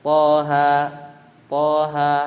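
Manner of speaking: "Toha" with even, moderately long vowels; measured model of "Toha"; two-count recitation. Shown as a demonstration. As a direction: say "Toha" on a level note, two beats each. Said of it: "bacanya itu dua harokat".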